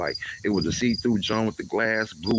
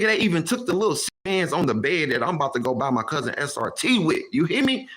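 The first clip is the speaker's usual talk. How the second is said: upset outcry; 5 semitones higher, 4 dB louder than usual. Real, not acted. Telling of breakdown, crying.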